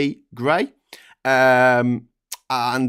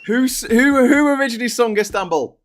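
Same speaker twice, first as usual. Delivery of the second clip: high, raised-voice quiz question; mock quizmaster; 9 semitones higher, 4 dB louder than usual. Springing a pop quiz on co-host, excited mock quizmaster.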